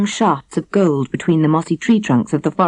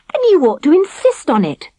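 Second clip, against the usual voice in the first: a touch of indignation, pitched high; indignant; 11 semitones higher, 3 dB louder than usual. A narrator's reading. Princess urges the young man to demand time off, mildly indignant. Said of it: "said the ordinary princess indignantly".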